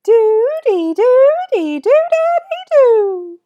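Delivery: loud, squeaky, drawn-out rhythm chant; super high-pitched voice. Shown as a demonstration. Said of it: "with a super high-pitched voice".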